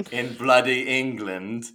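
hammed-up London-style British accent; mock British bravado; fake British accent. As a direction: boast in a put-on Brit voice. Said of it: "with this fake accent".

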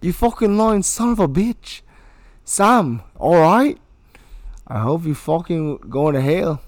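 loud, high, furious yelling; bully's furious rant, acting out a movie scene; acted rage. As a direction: spit every insult like you mean it. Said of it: "begin when you're ready to begin".

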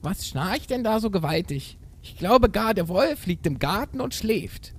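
high, animated little goat voice; little kid goat. A narrator's reading. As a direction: speak up as the young goat. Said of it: "rief da plötzlich das Geißlein". (hohe Geißlein-Stimme)

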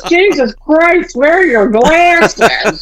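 high, drawn-out imitation of dad; hammed-up exasperated husband; mock exasperation. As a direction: stretch every word in fed-up disbelief.

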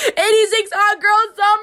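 high, loud whooping shout; shouted slogan. Acted. Host whooping her party slogan into the mic. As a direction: yell the slogan like a party cheer. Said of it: "I just shouted".

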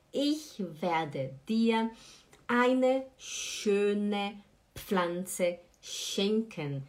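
eine schöne Pflanze schenken, drawn out very slowly; very slow model. Shown as a demonstration. Teacher models the sentence very slowly for students. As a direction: say each word slowly and clearly.

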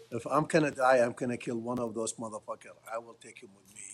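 ordinary talk, nothing marked

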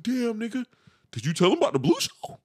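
self-centered friend. Retold or imitated. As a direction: play the friend steering it back to his own show. Friend voiced in retold call.

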